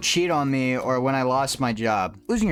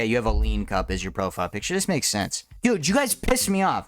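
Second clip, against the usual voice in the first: usual speaking voice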